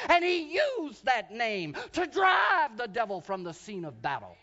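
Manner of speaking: loud, ringing sermon climax; preacher shouting about the name of Jesus; preaching at full force. Acted. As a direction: drive it home to the congregation.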